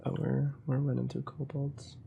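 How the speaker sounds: normal talking voice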